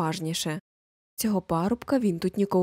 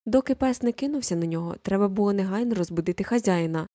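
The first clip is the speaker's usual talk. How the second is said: plain reading voice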